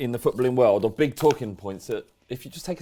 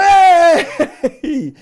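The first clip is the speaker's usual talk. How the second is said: long, high, loud "Hooray!"; crowd cheer; 13 dB louder than usual. Retold or imitated. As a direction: cheer her on as the whole stadium does. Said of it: "people going".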